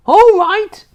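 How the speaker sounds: high, loud mocking yell. A narrator's reading. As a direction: yell the words back. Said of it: "she yelled".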